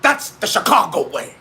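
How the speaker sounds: a low, light Sean Connery take on the movie line; lightly voiced Scottish mob-cop quote; Sean Connery impression. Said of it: "Sean Connery from The Untouchables".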